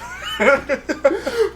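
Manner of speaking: a high laugh that breaks out. Real, not acted. Laughing at his co-host's question.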